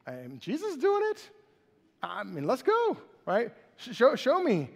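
put-on like a kid daring himself; impulsive Peter. Peter's imagined thoughts, giddy and high. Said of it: "what was Peter thinking?".

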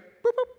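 toy-like beeping noise for a quick return; silly high-pitched sound effect; playful boop noise. Retold or imitated. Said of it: "If Christ just went".

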